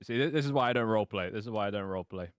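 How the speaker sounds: ordinary delivery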